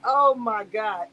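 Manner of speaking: normal talking voice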